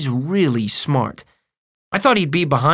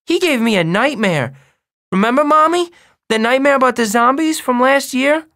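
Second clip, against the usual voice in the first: put-on high, loud small boy voice; eager little kid telling Mommy, very high; little brother; 13 semitones higher, 5 dB louder than usual. A narrator's reading.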